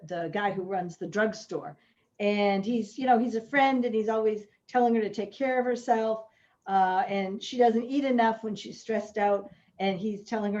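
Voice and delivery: ordinary talk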